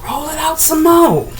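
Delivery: jumps up high on roll; high instruction. Shown as a demonstration. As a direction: chirp it up high.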